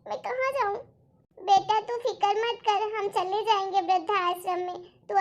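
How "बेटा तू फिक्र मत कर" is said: ordinary talk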